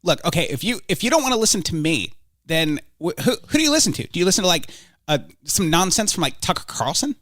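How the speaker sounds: lightly voiced pundit, needling; a touch of smug challenge; Ben Shapiro impression. Ben Shapiro threatening to bring in Tucker Carlson, lightly voiced. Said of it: "Ben Shapiro".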